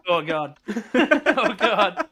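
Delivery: laughing through Oh God. Real, not acted. Laughing at his own hiccups.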